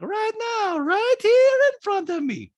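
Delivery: loud, high, slow mock echo; Diana. Diana echoing Sutton in disbelief, high mock impression. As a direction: throw it back way up high. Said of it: "Diana's like".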